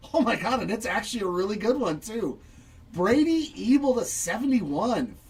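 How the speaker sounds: loud, high thrilled exclamation; real excitement over a great card; excited. Real, not acted. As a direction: shout your joy at a big pull. Pulls a great autograph.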